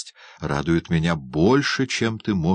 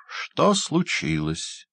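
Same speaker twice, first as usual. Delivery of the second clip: lightly voiced hunchback, slow and higher; slow hunchback question; 7 semitones higher, much slower than usual. Hunchback asks the doctor at the door, slow and mocking. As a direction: drag out "Что случилось?" as a sly hunchback. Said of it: "горбун".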